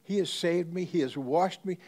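rising joy. Retold or imitated. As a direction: proclaim "He has saved me" with rising joy. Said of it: "she goes on and she says".

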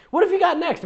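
high, bright-eyed question; eager acted question. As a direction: ask it high, as an excited friend. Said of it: "Madison asked".

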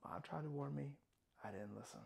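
very soft, low admission; hushed and inward; quiet regret. Acted. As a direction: drop right down to a regretful murmur. Monologue, regret after the outburst.

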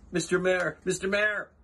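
high, urgent elephant's call. A narrator's reading. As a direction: call out to the tiny mayor. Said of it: "Horton called".

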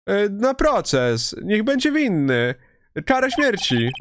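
a hint of an old lady demanding arrest; lightly voiced old lady crying thief; accusing old lady.

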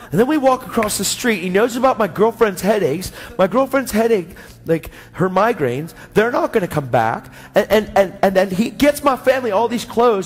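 excited retold outpouring, pitch climbing as it goes; imitating an astonished friend; amazed testimony. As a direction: blurt the whole unbelievable story to a stranger. Amazed friend recounts events to clerk.

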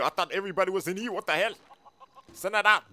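usual speaking voice

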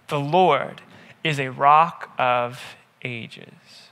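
usual speaking voice

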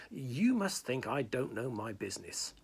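usual speaking voice

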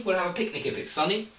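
We'll have a picnic if it's sunny rattled off in one quick breath; fast, no pause. Shown as a demonstration. Teacher shows the sentence fast with no pause. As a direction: rush it through without stopping. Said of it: "We say it very, very fast together".

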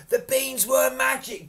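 high, loud exclamation; excited cry. A narrator's reading. Jack discovering the beans were magic, crying out. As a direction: call it out in wonder. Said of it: "Jack cried".

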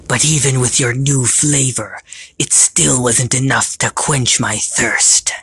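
slow, savoring villain voice; gloating villain creature. Acted. Villain tells Sam his heartbreak tasted different, gloating. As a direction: relish it, stretching the key words.

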